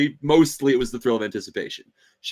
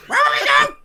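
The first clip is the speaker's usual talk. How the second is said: high princess voice; 9 dB louder, much faster than usual. Acted. Penelope's voice.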